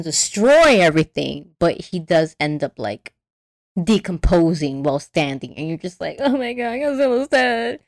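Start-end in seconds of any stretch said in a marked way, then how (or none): 6.2-7.8 higher, whiny mock grief; mock tearful. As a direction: wail lightly, as if crying. Retold grief at Godzilla's death.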